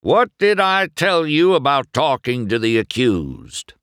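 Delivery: loud, high, stern sheriff; bellowed scolding. A narrator's reading. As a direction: chew out a subordinate. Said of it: "bellowed the sheriff".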